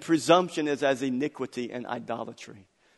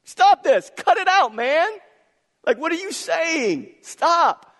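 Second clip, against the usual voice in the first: much higher, louder, with a laugh breaking through; loud exasperated shouting; 12 semitones higher, 7 dB louder, slower than usual. Acted. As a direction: shout him down in disbelief. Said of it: "That's how he said it".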